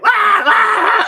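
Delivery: high, loud mock screaming of boys being torn apart; imitated screams. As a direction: shriek it out like victims in the distance. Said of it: "you can hear".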